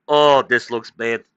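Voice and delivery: slow, mock-dismayed groan of a leader; a lightly voiced official face-palming; imitating embarrassed Iranian official. Iranian leaders reacting to their failure, mock dismay.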